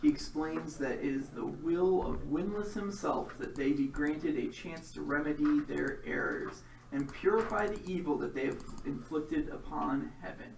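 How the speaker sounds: dramatic game master narration; story narration. A narrator's reading. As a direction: set the scene like a storyteller.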